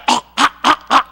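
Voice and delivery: imitated mama arguing, loud ah ah; mock arguing noises. Retelling mama arguing downstairs, loud mock yelling. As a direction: bark out the ah's like an argument heard upstairs. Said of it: "Mama hear her go".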